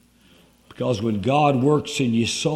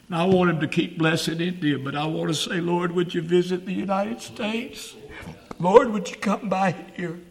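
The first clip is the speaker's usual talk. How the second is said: preach-pray it, climbing in urgency; fervent revival prayer; 6 semitones higher than usual. Real, not acted.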